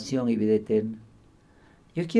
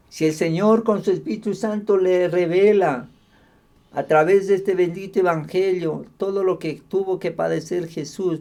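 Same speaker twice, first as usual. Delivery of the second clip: raised, louder preacher's voice; fervent preaching; 5 semitones higher, 6 dB louder than usual. Acted. Preacher to congregation on martyrs in Roman circuses, fervent preaching. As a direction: preach it out with rising force.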